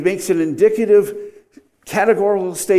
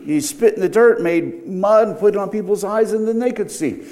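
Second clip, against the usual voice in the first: ordinary talk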